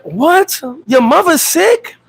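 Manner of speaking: high, drawn-out fake concern; mock surprise. Mock shocked reaction.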